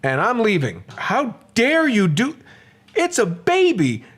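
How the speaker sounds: high, furious telling-off; a mother defending her baby from an insult; angry retort. Retold or imitated. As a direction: let the stranger have it. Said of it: "I said".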